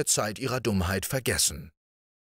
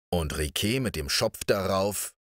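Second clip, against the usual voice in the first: usual speaking voice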